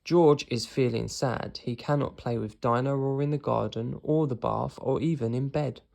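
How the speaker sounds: ordinary delivery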